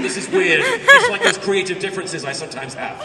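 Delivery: high and loud, bubbling with laughter; giggly high-pitched amusement; laughing excitedly. Real, not acted. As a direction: laugh through it, delighted at the weirdness.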